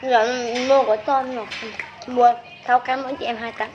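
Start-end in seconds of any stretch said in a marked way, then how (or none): none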